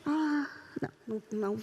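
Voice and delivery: ordinary talk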